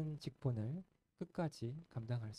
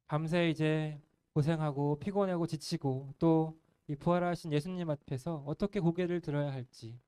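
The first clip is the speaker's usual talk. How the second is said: ordinary talk, nothing marked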